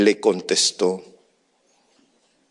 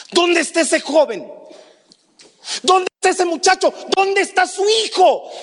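urgent questioning. Retold or imitated. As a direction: press him hard for an answer. Pastor demands to know about son. (preguntas insistentes en voz alta)

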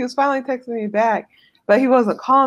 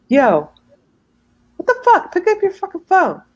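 pitched-up, exasperated demand; 10 semitones higher, 3 dB louder than usual. Retold or imitated. Girlfriend acting out texts to a boyfriend who won't answer, exasperated. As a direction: let the irritation jump high in pitch. Texting him angrily, acted out.

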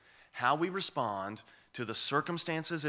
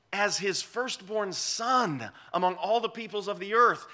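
booming preacher voicing the Lord; preaching proclamation; 8 semitones higher, 4 dB louder than usual. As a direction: ring out God's promise to the hall. Preaching at full force.

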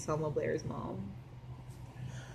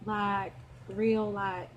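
twangy southern; 4 dB quieter, slower than usual. Shown as a demonstration. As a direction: demo of a twangy Southern accent. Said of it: "twangy like this".

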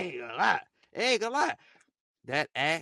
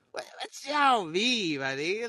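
acted-out baffled objection; retold protest; 7 semitones higher, 8 dB louder than usual. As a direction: protest loudly to your friends, stretching the words. Acting out his protest to friends.

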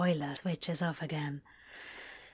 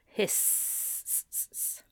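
hissing; 5 dB quieter than usual. Shown as a demonstration. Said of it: "James must be hissy".

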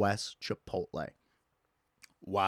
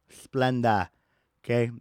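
ordinary talk, nothing marked

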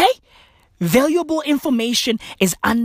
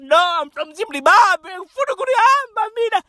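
much higher, crying wail; whiny wail; 8 semitones higher, faster than usual. Retold or imitated. Mocked Zimbabwean whining to go home. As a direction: whine it like a scared kid. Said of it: "They be like".

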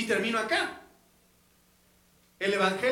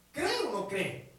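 ordinary talk

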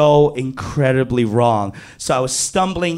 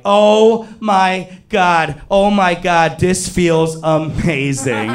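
slow, drawn-out acted ecstasy, pitched up; overwhelmed pleasure; 3 semitones higher, slower than usual. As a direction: stretch out each word in comic bliss. Acted overwhelmed pleasure in retold scene.

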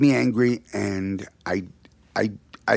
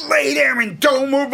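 raised, higher voice barking an order; stern order; 11 semitones higher, 4 dB louder than usual. Retold or imitated. Father ordering the boy to stay put, raised voice. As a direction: command it like an angry dad.

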